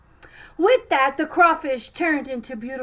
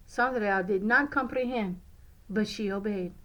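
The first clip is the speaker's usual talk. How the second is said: ordinary delivery, nothing marked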